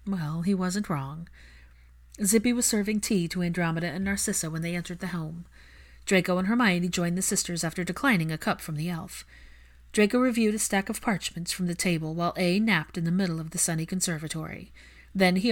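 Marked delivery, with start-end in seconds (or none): none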